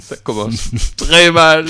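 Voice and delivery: loud, high, slow words through laughter; drawn out, breaking into giggles; real laughter. Real, not acted. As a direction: crack up while trying to talk. Cracking up as the show derails.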